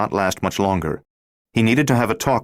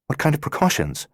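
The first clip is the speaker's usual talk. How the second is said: alarmed question; 9 semitones higher than usual. Acted. Laura alarmed.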